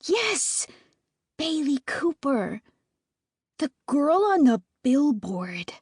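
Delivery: put-on high excited kid voice sinking to a whisper; high starstruck little sister; gushing little sister. A narrator's reading. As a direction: gush like a starstruck child. Little sister gushes about Bailey.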